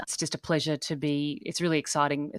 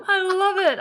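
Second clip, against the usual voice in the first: laughing; 7 dB louder than usual. Real, not acted. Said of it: "laughing at the Australian phone".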